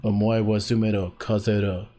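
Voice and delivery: Japanese character voice, put on; a game-style Japanese man's voice; Japanese trigger voice. Shown as a demonstration. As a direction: anime voice-acting warm-up line. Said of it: "just to get into the voice".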